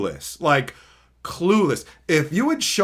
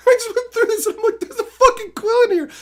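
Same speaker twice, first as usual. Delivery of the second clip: high, fast, laughing through the words; can't stop laughing at the find; laughing in disbelief; 4 dB louder, faster than usual. Real, not acted. Finding the quill, laughing.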